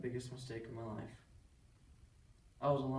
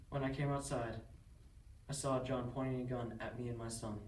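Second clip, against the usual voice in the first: ordinary talk, nothing marked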